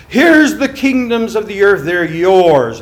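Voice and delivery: loud, high tempter's offer; grand seductive devil calling out; devil tempting. Acted. Preacher voicing the devil.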